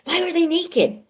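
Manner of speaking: mock-dramatic loud squeal; loud high question. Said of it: "we're gonna get loud".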